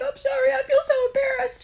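squeaky high, loud apology; shrill-high flustered apology to the nurse; mock embarrassed squeak. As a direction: replay your mortified self in a tiny high squeal. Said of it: "And I'm like".

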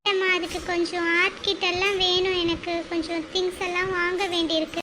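put-on teen daughter voice. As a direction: ask mum for art supplies gently.

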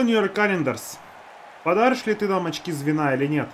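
normal talking voice

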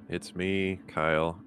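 usual speaking voice

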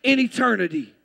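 loud, emphatic sermon delivery; preaching at full force. Real, not acted. Preacher promising deliverance from sin, preaching forcefully. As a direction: drive the promise home to the congregation.

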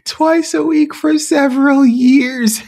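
high, loud amazed disbelief; amazed at the con's audacity; incredulous exclaim. Real, not acted. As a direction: cry it out in disbelief. Incredulous exclaim about con.

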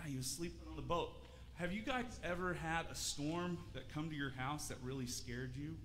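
ordinary delivery

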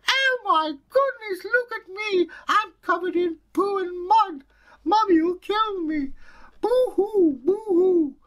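put-on very high, loud little girl wail ending in boo-hoos; a mucky girl crying in panic. Girl splattered with cow muck wails in high squeals.

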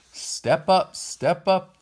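high, loud barker's call. A narrator's reading. Man at the ticket stand to the puppet show line, calling out. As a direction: call out to a waiting crowd. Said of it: "called the man waiting at the ticket stand".

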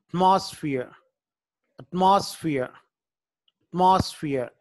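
Atmosphere said loud with the beat on the first syllable; stress on the T syllable. Shown as a demonstration. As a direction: model atmosphere for a class, punching the opening beat. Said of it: "టీ దగ్గర stress mark వస్తుంది".

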